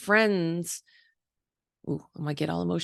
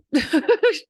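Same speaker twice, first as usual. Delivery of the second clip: laugh; 10 semitones higher, 6 dB louder than usual. Real, not acted. Laughing at own admission.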